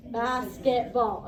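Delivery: slow, each syllable separated; drawn out syllable by syllable; syllables counted out. Shown as a demonstration. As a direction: tap out every beat of Basketball, BAS-KET-BALL. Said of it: "I'm gonna count the syllables".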